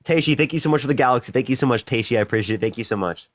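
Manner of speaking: ordinary talk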